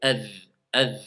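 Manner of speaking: Adh, adh with a soft dh, tongue between teeth; zal sound. Shown as a demonstration. Teacher isolating the zal sound for the class. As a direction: let the dh of Adh ring soft. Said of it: "Moi, je veux entendre le zal".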